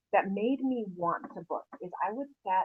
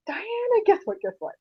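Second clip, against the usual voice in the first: louder, rushed, bursting to tell; calling Diana with big news, excited and louder; excited gossip; 6 semitones higher, 9 dB louder than usual. Shown as a demonstration. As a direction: call like you can't hold in the news. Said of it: "it sounds like I have a, gossip".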